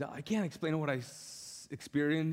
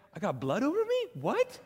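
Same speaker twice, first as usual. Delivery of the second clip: high-pitched incredulous questioning; mock alarm; 9 semitones higher than usual. New believer told of Jesus' blood, mock alarm. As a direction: pitch it up in comic disbelief. Retold alarm at brother's odd reassurance.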